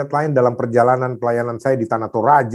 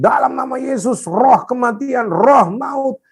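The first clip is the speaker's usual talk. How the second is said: high, forceful exorcising command; retold rebuke; 9 semitones higher, slower than usual. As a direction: re-enact rebuking a spirit of death. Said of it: "saya mulai tengking".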